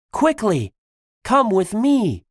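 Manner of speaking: high, pressing call; urgent command. Acted. Tigress hurries friends from the cannons, urgently. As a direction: urge them to hurry, pitched high.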